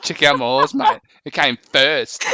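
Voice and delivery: light Australian bloke, bragging to a mate about his horse; a hint of Aussie swagger, showing off; nerdy Australian accent. Australian bloke bragging about his horse to a mate, in a light nerdy Australian accent. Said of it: "a nerdy Australian accent".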